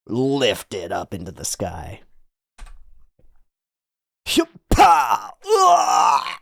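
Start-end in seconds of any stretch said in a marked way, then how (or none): 4.3-6.3 high straining grunts; effort grunts. Acted. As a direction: heave with a strained cry. Voicing effort of lifting.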